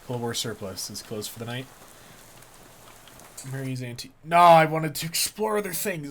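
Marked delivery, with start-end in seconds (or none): 4.2-6.1 loud, slow protest; half-laughing complaint at the game; frustrated outburst. Real, not acted. Complaining to the game, loud and slow.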